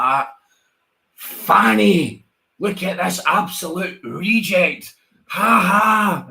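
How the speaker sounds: high, slow mocking jeer with a laugh. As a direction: sneer at the newcomer. Mocking contestants on the show.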